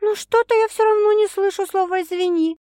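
put-on high girl's voice, pointed and demanding; demanding young woman. A narrator's reading. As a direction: make him say it, insist. Sasha's voice in narration.

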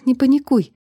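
steady yourself, urgently; telling herself. A narrator's reading. Girl calming herself in panic, tense. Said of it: "успокаивала себя я".

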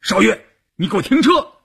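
high-pitched forceful demand; struggling, urgent order. A narrator's reading. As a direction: order the driver while struggling to get free. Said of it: "关山林挣扎着说". (挣扎着高声喝令)

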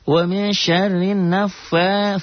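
high, slow chanted recitation; Quran recitation demo. Shown as a demonstration. Teacher chanting the verse for students, slow and high. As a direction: draw out the Arabic like a reciting teacher. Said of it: "fa-nya mad asli".

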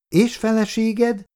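put-on high, drawn-out child's question; little girl. A narrator's reading. Little girl asks about his wife, high and slow. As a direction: ask it high and slowly, like a child.

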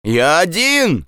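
high, drawn-out shout. Acted. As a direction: call it out slowly and high. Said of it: "крикнул Андрес". (протяжный крик)

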